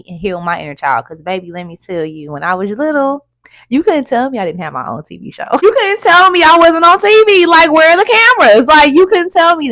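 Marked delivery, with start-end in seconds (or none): 5.6-9.7 high, loud, fast delighted boasting; excited. Retold or imitated. Recalling her childhood sure she was on TV, loud and thrilled. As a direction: belt it out like a kid sure she's a star. Bragging about childhood TV fantasy.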